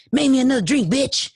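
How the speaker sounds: normal talking voice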